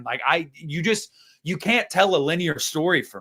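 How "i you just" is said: ordinary talk, nothing marked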